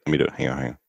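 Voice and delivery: usual speaking voice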